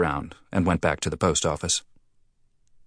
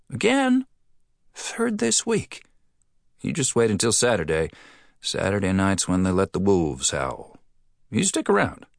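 a chatty cafe owner, raised pitch; 3 semitones higher than usual. Acted. Restaurant man's gossipy reply.

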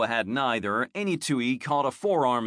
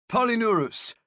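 raised voice; 7 semitones higher, 3 dB louder than usual. A narrator's reading. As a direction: call it out firmly to one man. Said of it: "Alario requested".

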